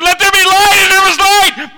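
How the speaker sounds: booming, high and loud, like a divine decree; God's booming command. Acted. God's voice commanding light, booming shout. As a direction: proclaim it as God creating light. God speaking creation into being.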